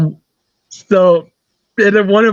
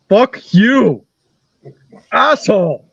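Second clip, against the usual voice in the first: higher-pitched retold outburst, drawn out; mock angry yell; 4 semitones higher, much slower than usual. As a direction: imitate a caller blowing up without really screaming. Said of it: "he starts screaming at me".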